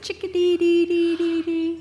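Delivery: high bright bird chirp; chickadee call. Shown as a demonstration. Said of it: "I thought they said".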